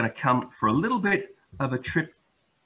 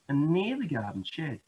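usual speaking voice

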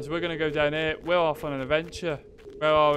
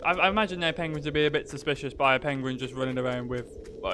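ordinary talk, nothing marked

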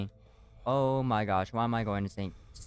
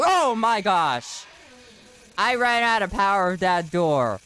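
panicked outburst; 7 semitones higher, 5 dB louder than usual. Real, not acted. Dies in game, panics.

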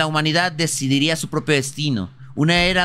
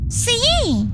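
excited yell. Real, not acted. Celebrating loudly.

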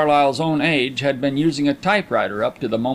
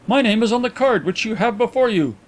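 stiff, higher-pitched correction; 9 semitones higher than usual. A narrator's reading. Annoyed visitor corrects his host about his name, stiff. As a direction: correct him with cool formality. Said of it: "he replied stiffly".

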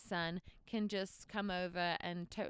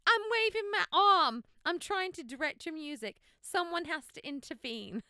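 high, loud and frantic, arm waving; begging the congregation for help, exasperated; mother conducting music pleads for help, frantic and loud; 7 semitones higher, 8 dB louder than usual. Retold or imitated. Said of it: "I'm like".

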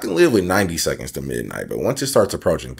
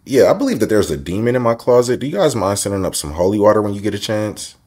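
polite phone voice; 5 semitones higher, 5 dB louder than usual. Pretend. Mock phone call to hotel.

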